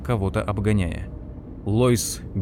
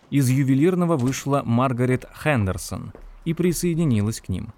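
ordinary delivery

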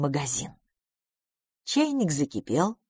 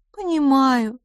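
high-pitched, mildly sorrowful; a touch of sadness, voice lifted; sad; 8 semitones higher, 4 dB louder, slower than usual. A narrator's reading. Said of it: "грустно сказала мисс Лафос". (грустно, высоким голосом)

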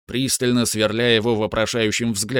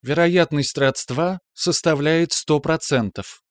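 ship computer voice; 5 semitones higher, slower than usual. Acted. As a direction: report evenly like a ship's AI. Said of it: "мягкий женский голос".

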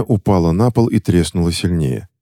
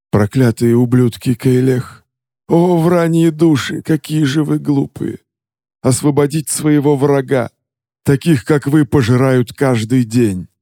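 scornful goblin king; 7 semitones higher than usual. Acted. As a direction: rant down at the fools. Goblin king's contemptuous rant.

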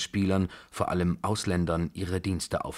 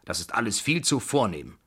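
put-on higher, emphatic old matriarch; bossy old Babuschka; 5 semitones higher, 3 dB louder than usual. Babuschka rejecting the hotel's fancy bedding, bossy and emphatic. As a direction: order the servants about imperiously.

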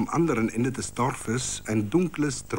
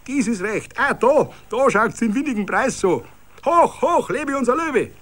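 put-on high, loud children's cheering; excited kids bawl their hurrah; 12 semitones higher, 5 dB louder than usual. A narrator's reading. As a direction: cheer wildly for the lion. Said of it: "plärrten".

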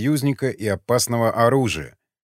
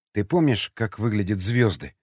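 ordinary delivery, nothing marked

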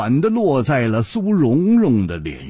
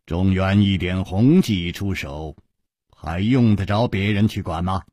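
low, easy hero voice; composed low-pitched reassurance; unruffled amusement; 7 semitones lower than usual. A narrator's reading. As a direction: answer calmly, pitched well down. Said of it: "楚留香笑了笑".

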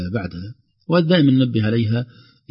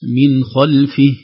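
ordinary delivery

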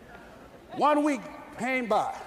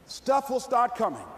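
ordinary talk, nothing marked

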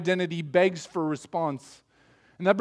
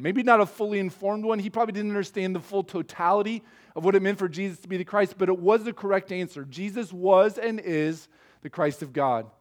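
usual speaking voice

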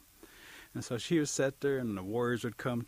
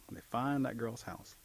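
plain reading voice

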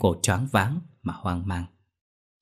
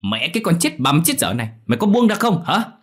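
high furious shout, building louder; enraged yelling; 9 semitones higher, 5 dB louder than usual. A narrator's reading. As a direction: curse and demand to be let go. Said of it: "điên tiết quát lớn".

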